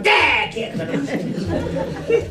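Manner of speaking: comic put-on voice of a woken spouse; mock snappish wife. Wife rudely woken by the shout.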